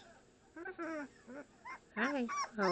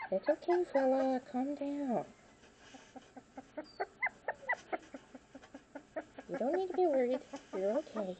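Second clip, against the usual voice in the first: soothing a pet; 6 semitones higher than usual. Real, not acted. Calming the nervous pet.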